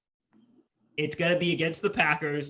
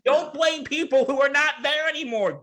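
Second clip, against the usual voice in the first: high, insistent mock scolding; worked-up, pitched-up retort; exasperated; 12 semitones higher, 4 dB louder than usual. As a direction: plead loudly with someone who won't listen. Exasperated retort aimed at the coach.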